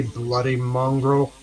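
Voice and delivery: slow, put-on Aussie drawl; mock-Australian catchphrase voice; imitated Australian accent. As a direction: have a go at an Australian accent, taking it slowly. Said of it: "trying to do our Australian accent".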